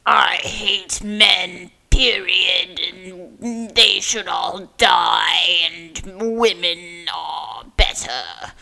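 slow, loud mock declaration; extreme man-hater caricature. Podcaster mimicking extreme feminism, slow loud zealot voice. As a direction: pound out each phrase as a hard-line zealot.

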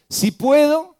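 indignant rising "Si puedo"; incredulous "si puedo". Acted. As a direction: echo the doubt back in disbelief. Preacher repeats Jesus' retort.

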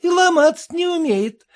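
высокий насмешливый бабий говор; поддразни, как рыночная торговка; баба-торговка. A narrator's reading. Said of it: "баба-торговка произнесла". (торговка у калитки насмешливо)

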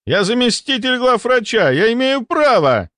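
high-pitched, outraged self-important official; indignant protest. A narrator's reading. Deputy defends himself before staff, high indignant protest. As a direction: insist on rank in a high, strained pitch.

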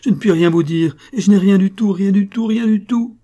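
loud, high, desperate outburst; explosion of anguish and rage. A narrator's reading. Servant girl denying everything to her mistress, anguished outburst. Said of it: "avec une explosion de douleur et de rage".

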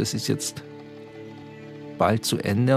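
ordinary talk

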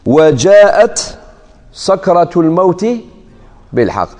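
slow, recited opening on "وجاءت"; Quranic recitation. Shown as a demonstration. Teacher reciting the verse before its variant. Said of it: "مثال آخر".

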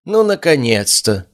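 high, drawn-out condescending greeting; patronizing smile. A narrator's reading. Cassian greets Chloe's late arrival, patronizing. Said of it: "с покровительственной улыбкой".

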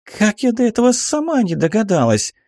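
put-on high-pitched delighted girl; thrilled at the idea; joyful exclamation. A narrator's reading. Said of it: "радостно воскликнула Аллегра".

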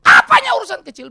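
high, loud indignant outburst; angry patient. Acted. Offended patient snapping back at the doctor, angry. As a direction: shout back in mock outrage. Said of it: "Marah dia".

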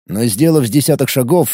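usual speaking voice

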